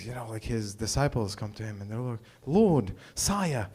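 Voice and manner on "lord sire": lightly put-on British accent; mock British disciple, a little higher. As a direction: address him in movie-style British. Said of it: "I don't know why they're British".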